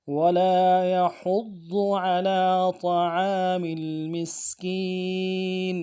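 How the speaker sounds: slow melodic tajweed chant; Quran recitation. Shown as a demonstration. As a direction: recite the verse with care.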